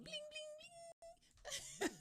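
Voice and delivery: high sung bling chime. Real, not acted. Host celebrating packages on time, sung high. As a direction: chime it like a bell.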